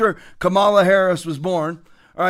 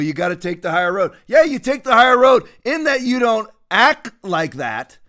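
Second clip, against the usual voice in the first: normal talking voice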